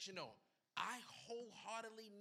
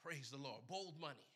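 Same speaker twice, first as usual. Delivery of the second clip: usual speaking voice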